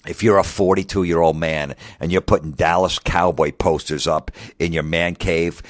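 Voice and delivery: ordinary talk, nothing marked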